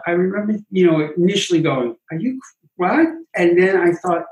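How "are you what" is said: startled disbelief. Retold or imitated. Reacting to [name] suggestion.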